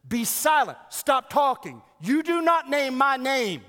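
shouted, high commanding rebuke; Jesus silencing the demon, thundering; powerful rebuke. Acted. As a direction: bellow it like banishing a demon. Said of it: "powerfully rebukes him".

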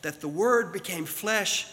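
loud, high, stretched-out preaching; preaching at full force. Acted. Preacher building to the climax for the church, full force. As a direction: drive the message home to the congregation.

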